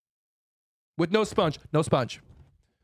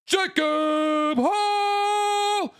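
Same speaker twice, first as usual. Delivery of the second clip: UFC announcer; 6 semitones higher, much slower than usual. Acted. Introducing the condemned man to the crowd, booming UFC ring announcer. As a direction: stretch every word like a ring announcer hyping the main event. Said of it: "let it be U F C style".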